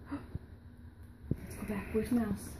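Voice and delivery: normal talking voice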